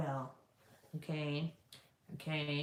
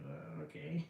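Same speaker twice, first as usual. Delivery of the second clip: very quiet, crestfallen murmur; mock heartbroken; 3 semitones lower, 11 dB quieter than usual. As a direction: imitate a rejected suitor, barely audible. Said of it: "he just looked so heartbroken".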